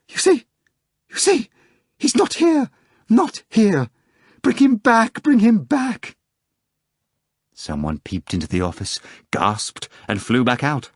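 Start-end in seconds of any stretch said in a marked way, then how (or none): 0.1-6.2 very high, loud and frantic; shrieking exclamation. A narrator's reading. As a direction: beg hysterically, voice way up.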